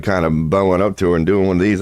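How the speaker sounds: usual speaking voice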